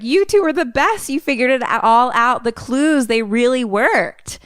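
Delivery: high, excited gushing; gushing praise. Retold or imitated. Muffy thanks the two best players, gushing excitedly. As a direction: imitate a delighted host heaping praise. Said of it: "And Muffy's like".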